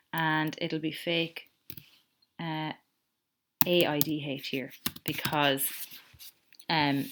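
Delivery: ordinary delivery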